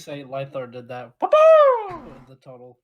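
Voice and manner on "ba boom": loud, high mock explosion noise; shouted "ba-boom". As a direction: boom it out high like a cartoon blast. Player imitates an explosion loudly.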